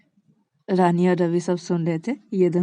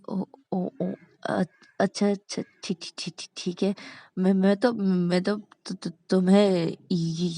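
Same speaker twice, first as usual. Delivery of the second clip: repeated syllables, haltingly flustered; nervous stammer. Acted. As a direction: stammer as if scared to talk. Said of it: "ऐसे हकला क्यों रही हो".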